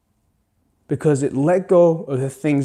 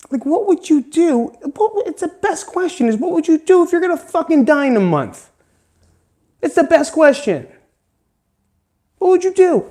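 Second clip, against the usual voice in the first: excited; 12 semitones higher, 3 dB louder than usual. Real, not acted. Ranting about dying in a month.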